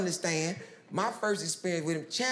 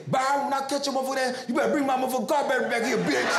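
higher, fast yelling after a thief; mock yell; 7 semitones higher, 4 dB louder, faster than usual. Owner yelling at Byron to bring the battery back, fast and high. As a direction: holler after him, rapid and pitched up.

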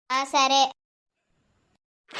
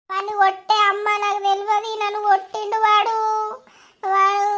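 put-on child voice; 5 semitones higher than usual. Girl whines to mother.

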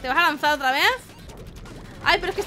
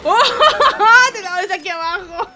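giggling through the words, higher; laughing gleefully; 5 semitones higher, 8 dB louder than usual. Real, not acted. Player hits the boss from below, gleeful giggling. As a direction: laugh in delight at a lucky hit.

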